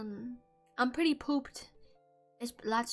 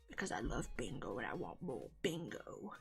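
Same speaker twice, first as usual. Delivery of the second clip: much lower, softer goofy voice; deep silly voice; 13 semitones lower, 12 dB quieter than usual. Pretend. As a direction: drop into a dopey low voice. Goofy put-on voice.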